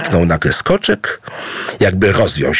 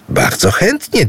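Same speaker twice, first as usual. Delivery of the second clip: exclaimed; 12 semitones higher than usual. Acted. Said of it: "wykrzyknął Idu".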